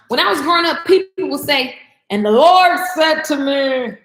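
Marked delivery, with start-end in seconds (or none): 2.1-4.0 slow, solemn preacher cadence, a bit louder; mock pious announcement; lightly imitating a churchy tone. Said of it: "people start talking like that".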